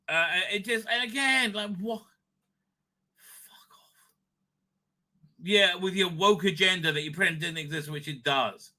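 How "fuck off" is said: whispered dismissal; whispered fuck off. Real, not acted. Brushing off the woke agenda, a whispered fuck off. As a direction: hiss it as a whisper.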